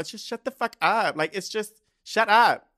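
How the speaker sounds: high, loud fed-up shout; exasperated shout. Real, not acted. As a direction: yell it, completely done. Exasperated rant climax.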